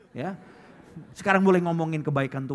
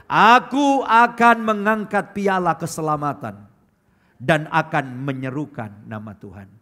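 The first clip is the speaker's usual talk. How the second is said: congregational reading; 8 dB louder, slower than usual. Real, not acted. Preacher leads the church reading the psalm aloud, loud and slow. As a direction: read the verse together, slow and full. Reading last verse together.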